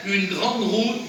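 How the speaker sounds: ordinary talk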